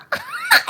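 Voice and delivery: sudden high burst of laughter; real laugh. Real, not acted. Laughing at how strange his apology felt, high and loud.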